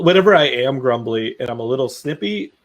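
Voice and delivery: ordinary talk, nothing marked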